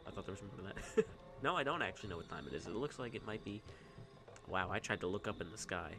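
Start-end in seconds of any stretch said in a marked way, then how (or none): none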